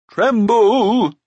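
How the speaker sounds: loud, high, wavering giant's command; quavering cry. A narrator's reading. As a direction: cry it out, drawn out and wobbly. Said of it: "the quavering voice cried again".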